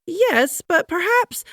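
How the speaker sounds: very high, lilting "perhaps"; musing wife. A narrator's reading. First Lady musing over her stuck husband. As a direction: drift off thoughtfully, high.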